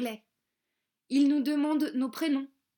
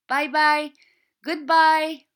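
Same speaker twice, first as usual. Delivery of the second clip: higher, loud goodbye call; 4 semitones higher, 10 dB louder, slower than usual. Acted. Children on the train call to parents, loud and higher. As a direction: wave and shout bye bye.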